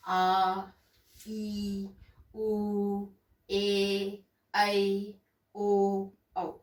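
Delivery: each vowel आ ई उ ए ऐ ओ औ held long and slow; दीर्घ स्वर, धीरे और लंबा; long vowels drawn out. Shown as a demonstration. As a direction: stretch every vowel आ, ई, उ out slowly. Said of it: "दीर्घ स्वर वो होते हैं जिनके उच्चारण में हमें ज्यादा समय लगता है".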